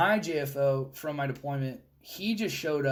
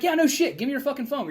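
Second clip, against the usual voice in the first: high, loud mock anger; snapping at the kid; retold angry demand; 8 semitones higher, 4 dB louder than usual.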